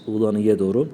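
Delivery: ordinary talk, nothing marked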